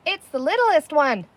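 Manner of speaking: calling out. Acted. Villager calls out escape.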